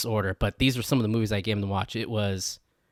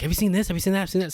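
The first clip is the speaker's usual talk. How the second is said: mock excited, pitched up high; eager pestering; 8 semitones higher, much faster than usual. Imitating his old self badgering a friend about shows, high and eager. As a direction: rattle off the questions like an overeager fan. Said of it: "I was like".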